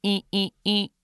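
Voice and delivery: high, beeping electronic error tone; imitated scanner beep. Retelling how the ticket scanner rejected his phone, beeping mimicry. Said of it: "and it just goes".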